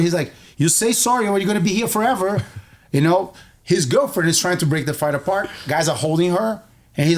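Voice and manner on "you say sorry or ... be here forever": higher, quick mock warning; retold threat. Cousin to the pinned bully, insistent warning. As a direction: imitate an insistent victor, fast and pitched up.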